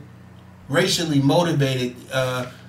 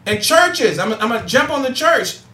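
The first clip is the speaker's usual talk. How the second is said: higher, driving preacher's cadence building up; impassioned call to action; preaching; 7 semitones higher than usual. Acted. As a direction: fire up the audience like a Sunday sermon. Rises into preaching to listeners.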